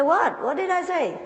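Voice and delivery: high, incredulous "What?"; confused disbelief; baffled owner. Retold or imitated. Owner asking the plumber, high baffled disbelief. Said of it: "He said".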